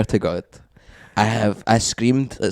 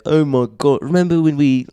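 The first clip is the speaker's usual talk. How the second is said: put-on higher-pitched, lightly done Kiwi accent; 6 semitones higher than usual. One of the passers-by outside the flat, imitated in a Kiwi accent. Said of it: "done a fucking Kiwi accent there".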